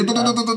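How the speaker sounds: high, repeated stutter of a gear; imitated failed shifting. Menirukan gigi gagal masuk berulang. (tiruan bunyi gigi gagal masuk, bernada tinggi)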